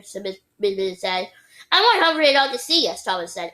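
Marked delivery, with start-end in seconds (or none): 1.7-3.0 put-on higher-pitched child's demand; insistent. A narrator's reading. Boy urging teacher, insistent higher voice.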